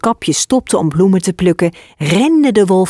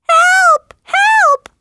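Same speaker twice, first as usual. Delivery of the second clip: much higher, louder panicked cries; girl fleeing the wolf screaming for help; 3 dB louder than usual. Acted. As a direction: shriek as if fleeing for your life. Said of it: "rende schreeuwend uit het huisje".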